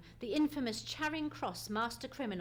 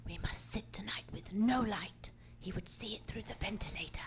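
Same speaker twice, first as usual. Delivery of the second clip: whisper. Acted. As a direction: breathe the words so no one hears. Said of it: "speak only like this".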